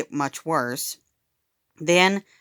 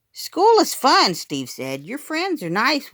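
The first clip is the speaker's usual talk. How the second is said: put-on happy little boy; 9 semitones higher than usual. A narrator's reading. Steve voiced as little kid.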